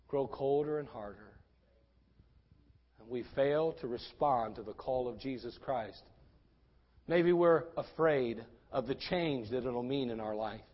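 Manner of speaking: low, soft, measured reflection; quiet, grave appeal; sombre preaching. Real, not acted. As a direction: bring it right down after the climax. Sombre reflection after the peak.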